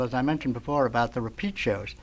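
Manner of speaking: ordinary talk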